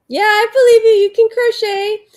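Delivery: high, loud burst of encouragement; real, enthusiastic high-pitched cheer; excited cheering. Real, not acted. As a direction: cheer them on loudly, voice shooting up. Cheering on a fellow crocheter.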